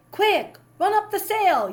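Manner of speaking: urgent yell, high and loud. A narrator's reading. Older brother yelling to raise the sail, loud urgent yell. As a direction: bark the order to hoist sail. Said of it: "yelled Nathan".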